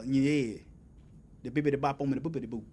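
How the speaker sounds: lazily scat the slang like a mock homeboy; a playful imitation of slangy street talk. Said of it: "If you ain't talking".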